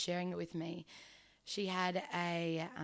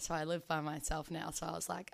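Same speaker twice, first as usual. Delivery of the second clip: ordinary talk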